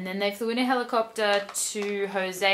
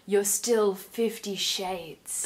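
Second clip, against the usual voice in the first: slow, drawn-out mock inner voice; italic inner voice; much slower than usual. Reader voicing the heroine's inner thought, slow and drawn out. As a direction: stretch it like a dramatic thought. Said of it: "she thinks in italics".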